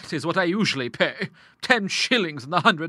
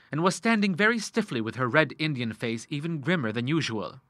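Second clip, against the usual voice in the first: plain reading voice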